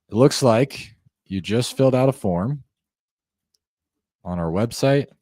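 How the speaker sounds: slow with long pauses between phrases; calm, slow pacing; slow, paced delivery. Shown as a demonstration. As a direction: give them time to take it in. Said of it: "I'm keeping the pace slow".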